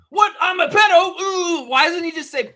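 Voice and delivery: shrill, very high, loud disbelief; hammed-up high-pitched shock; mock shocked outrage. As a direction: squeal it like a caught man playing shocked. Acting [name]'s shocked outrage.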